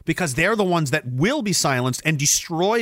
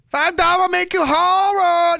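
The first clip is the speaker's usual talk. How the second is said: even higher, louder, drawn-out Vietnamese caricature; mock Vietnamese accent; 6 dB louder, slower than usual. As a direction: shriek it slowly in a put-on Vietnamese accent. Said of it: "No, it's a Vietnamese hooker".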